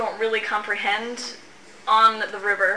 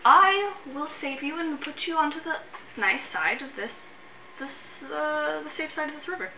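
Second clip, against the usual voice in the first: a lightly higher, wheedling imp making an offer; sly imp voice; 5 semitones higher, 4 dB quieter than usual. Acted. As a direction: coax them like a tricky little imp.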